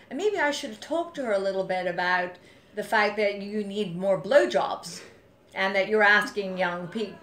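corner him with it, voice rising; confronting. Retold or imitated. Confronting a bully in retold call.

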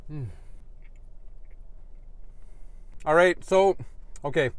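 talking while chewing; muffled by food in mouth; mouth full, chewing. Real, not acted. Seen 3: Bites at -2, cheeks full and chewing until about 4.6.

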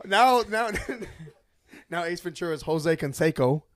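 loud, talking through laughter; incredulous, laughing recap. Real, not acted. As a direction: crack up while trying to get it out. Cracking up at mistakes.

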